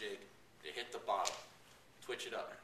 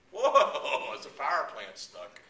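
a much higher, loud whoa that dissolves into laughter; mock amazed snook; 5 semitones higher, 12 dB louder, slower than usual. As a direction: play the startled fish for laughs. Voicing snook seeing glowing lure.

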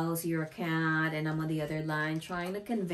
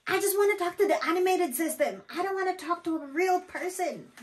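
high, quick mock-angry complaint; imitated irate caller; 12 semitones higher, 4 dB louder than usual. Customer refusing to talk to a real person, mock angry. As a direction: squeal it as a fed-up customer. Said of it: "Because we always get that".

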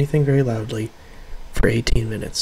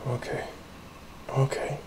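quiet; 2 semitones higher, 13 dB quieter than usual. Real, not acted. Calming self, very soft.

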